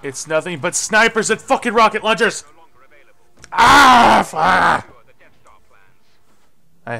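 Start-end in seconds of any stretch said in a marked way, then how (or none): 0.0-5.0 loud, high furious rant ending in a scream; raging shout. Real, not acted. Gamer raging at snipers and rockets, furious shout. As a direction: rage at the unfair enemies.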